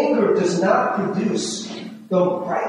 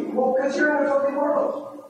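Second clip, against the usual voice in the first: ordinary talk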